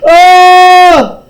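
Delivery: high, loud shriek of terror; full loud terrified scream. Acted. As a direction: shriek in horror. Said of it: "I screamed".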